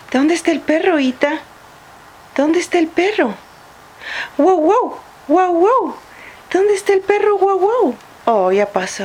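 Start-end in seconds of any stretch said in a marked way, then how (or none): 0.1-3.3 put-on reading to a toddler. Asks toddler where the dog is. 4.4-6.0 dog bark. Acted. Mother barks for picture dog. 6.5-7.4 put-on reading to a toddler. Asks toddler where the dog is. 7.4-8.0 dog bark. Acted. Mother barks for picture dog.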